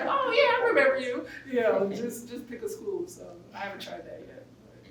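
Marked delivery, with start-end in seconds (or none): 0.0-1.2 high bright gush of recognition; excited recognition. Retold or imitated. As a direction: gush like an old classmate. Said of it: "People will be like".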